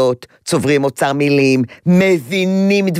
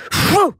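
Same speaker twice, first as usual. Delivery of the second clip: sharp puff; 13 semitones higher than usual. Shown as a demonstration. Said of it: "מאוד חזק בפה".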